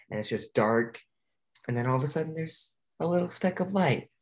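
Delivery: ordinary talk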